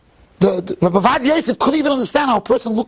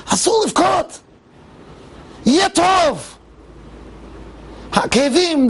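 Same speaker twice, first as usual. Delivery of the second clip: higher, pleading consolation to a crying friend; loving comfort; 8 semitones higher, much slower than usual. Retold or imitated. Old rabbi begs his crying friend, high pleading comfort. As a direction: soothe him like a weeping child, voice raised. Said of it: "So Scheinberg said".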